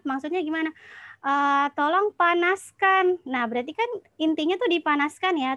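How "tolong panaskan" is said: "panaskan" slow and raised, pitched high and loud; intonasi naik di tengah. Shown as a demonstration. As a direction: lift your voice on "panaskan" as a command. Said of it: "intonasinya naik pada tengah kalimat". (intonasi perintah, "panaskan" naik tinggi dan tegas)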